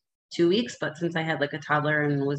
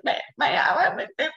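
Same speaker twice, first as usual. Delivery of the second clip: high, wordless mock blubbering; comic bleating sobs, pitched up; imitated incoherent crying; 9 semitones higher than usual. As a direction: babble as if crying too hard to form words. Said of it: "I was crying. Like, they couldn't understand".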